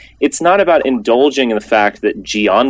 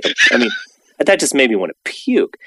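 ordinary delivery

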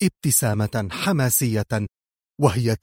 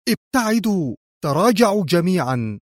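high, firm warning; raised-pitch call to stand clear; firm command; 6 semitones higher than usual. A narrator's reading. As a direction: order everyone back decisively. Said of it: "قائلة في حزم".

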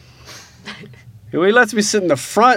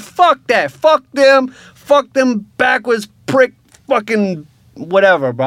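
high, loud furious yelling; 9 semitones higher, 4 dB louder than usual. Retold or imitated. Son to his parents, furiously yelling about the police. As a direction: yell it out, raging.

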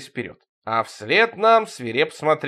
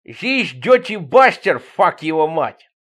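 high, loud, slow put-on voice; slow, high-pitched comic swearing; cursing diver character; 4 semitones higher, 7 dB louder, slower than usual. A narrator's reading. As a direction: drag out the mangled English curse.

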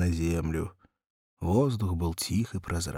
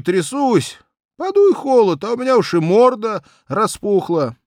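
put-on higher, complaining young cabman; whiny cabman Vanka; 14 semitones higher, 10 dB louder than usual.

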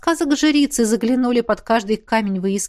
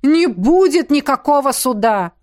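higher, commanding protest; indignant raised-voice declaration; forceful exclamation; 4 semitones higher, 4 dB louder than usual. A narrator's reading. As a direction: overrule the guard emphatically. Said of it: "воскликнула Ванда".

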